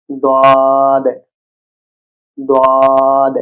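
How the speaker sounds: দাদ with the vowel stretched three alif long; drawn-out vowel. Shown as a demonstration. Teacher drilling students on a long দাদ. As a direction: hold the আ in দাদ long and heavy. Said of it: "তিন আলিফ টান দিয়ে পড়তে পারি".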